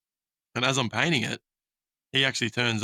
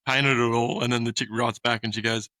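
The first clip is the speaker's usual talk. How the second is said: normal talking voice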